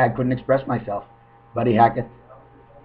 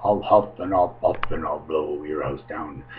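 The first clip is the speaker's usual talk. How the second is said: much lower, menacing wolf voice; big bad wolf; 8 semitones lower, 4 dB quieter than usual.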